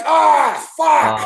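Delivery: sudden loud cry of pain; pained yell. Retold or imitated. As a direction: yelp as the wire cuts. Ripped stomach on barbed wire.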